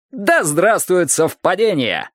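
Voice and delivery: rousing, slow cry of delight; gleeful exclamation. A narrator's reading. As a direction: proclaim it with gusto like a toast. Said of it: "азартно воскликнул один из пилотов".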